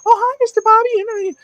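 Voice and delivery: very high, squeaky-pitched friend impression; mock kid voice. Daughter's friend greeting her dad, exaggerated high kid voice. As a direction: imitate a child's greeting in loud falsetto. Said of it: "does he talk like that?".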